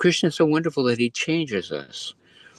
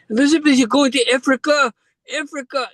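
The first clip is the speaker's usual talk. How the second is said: put-on sing-song Kiwi accent, pitched way up like an excited preacher; 6 dB louder than usual. Mega church preacher hailing Elizabeth from the stage, in a high Kiwi accent. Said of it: "They had this Kiwi accent".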